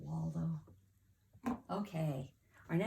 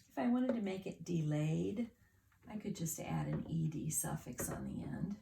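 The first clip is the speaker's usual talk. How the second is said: ordinary talk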